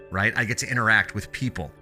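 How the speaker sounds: usual speaking voice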